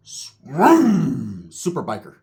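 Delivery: slow, stretched announcer call; drawn-out ring announcer voice; hype announcer intro. Acted. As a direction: announce him like a wrestling entrance. Hyped stage introduction of a character.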